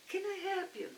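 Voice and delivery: high, bright clerk voice offering help; helpful young clerk. Retold or imitated. Young clerk offering help, high-pitched. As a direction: pitch way up as the eager store clerk.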